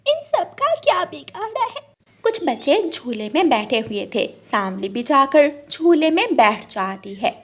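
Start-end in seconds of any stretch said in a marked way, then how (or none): 0.1-1.8 put-on high, hurt little girl's voice; a small bird asking why everyone hates her. Little bird sulks to herself.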